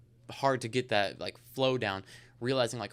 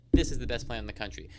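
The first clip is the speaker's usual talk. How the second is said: firm, louder sales declaration; confident pitch; 5 dB louder than usual. Shown as a demonstration. Salesman telling a client about the plan, confident and emphatic. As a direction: state it as an emphatic fact. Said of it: "say it like Perry does".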